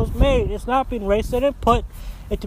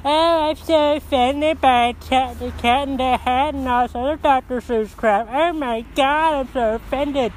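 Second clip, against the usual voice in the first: mock offended whining; 4 semitones higher than usual. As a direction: mimic a pearl-clutcher with exaggerated, dragged-out outrage. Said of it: "I doubt it's the kids going".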